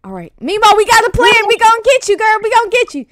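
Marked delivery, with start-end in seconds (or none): none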